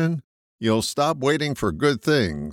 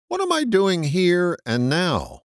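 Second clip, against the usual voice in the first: "here" lifted high and pressed, the whole question raised; stress on here; 8 semitones higher than usual. Shown as a demonstration. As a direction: hit "here" hard, then "and now". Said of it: "I put the accent on the word here".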